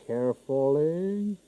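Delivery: drawn-out, slow coaxing word; slow careful. Real, not acted. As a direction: stretch it out gently, slowly.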